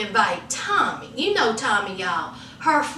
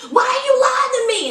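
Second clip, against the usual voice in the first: high, fast demanding shriek; furious wife; 9 semitones higher than usual. Retold or imitated. Pregnant wife demanding the truth from her husband, high and fast. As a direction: demand the truth, pitch soaring. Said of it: "she was like".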